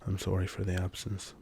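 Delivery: normal talking voice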